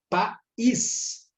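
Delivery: "país" split slowly, PA-ÍS, weight on the Í; teacher sounding out the stressed Í in "país"; stressed final syllable. Shown as a demonstration. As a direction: draw out "país" with a strong ÍS. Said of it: "expressada com mais força".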